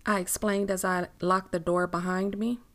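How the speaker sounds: ordinary delivery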